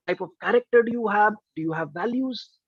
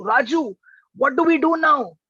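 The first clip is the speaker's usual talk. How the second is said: panicked cry for help, higher and louder; scared; 7 semitones higher, 5 dB louder than usual. Retold or imitated. Scared professor calling to the boatman, voice raised. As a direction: call out in acted fright, pitch jumping up. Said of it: "he becomes very scared".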